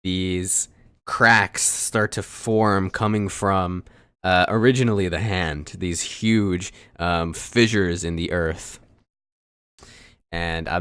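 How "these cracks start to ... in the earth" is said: slow, weighty narration; game master scene setting. Acted. Game master describing a sudden earthquake to players, slow dramatic narration. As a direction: draw out the disaster, slowly and emphatically.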